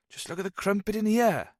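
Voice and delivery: high cheeky Welsh aside; leering Welsh quip; Welsh accent. A narrator's reading. Jones eyes the women in a Welsh accent. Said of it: "The Welshman's accent".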